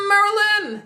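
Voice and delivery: high, loud, stretched-out call across the room; calling out a name. Shown as a demonstration. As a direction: yell her name like hailing a friend. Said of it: "That's how I say it".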